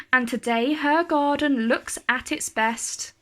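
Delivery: plain reading voice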